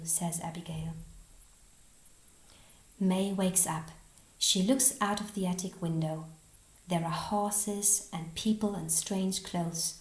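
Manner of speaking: usual speaking voice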